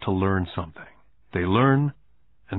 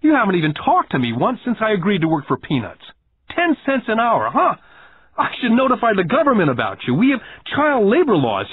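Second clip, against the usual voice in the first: put-on high-pitched, indignant boy making threats; acted child's heated complaint; angry tirade; 10 semitones higher, 4 dB louder than usual. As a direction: keep railing at the boss, worked up. Angry boy continues accusing boss.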